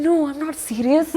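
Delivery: playfully offended denial; mock offended. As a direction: imitate someone slowly protesting, put out. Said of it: "he was very offended".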